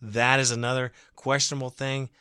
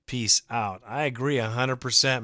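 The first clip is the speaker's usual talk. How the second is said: ordinary talk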